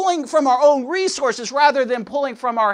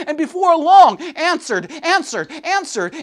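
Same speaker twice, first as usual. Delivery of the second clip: preaching shout; 7 semitones higher, 6 dB louder than usual. Acted. Preacher rising to climax.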